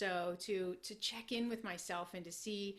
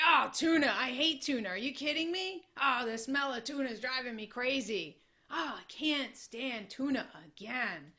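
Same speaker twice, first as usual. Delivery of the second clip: higher, louder, moaning over his lunch; whiny complaining; 4 semitones higher, 6 dB louder than usual. Retold or imitated. Coworker moaning to his buddy about his tuna sandwich, whiny complaint. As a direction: groan about the tuna like it ruins your day. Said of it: "he would complain about this tuna sandwich".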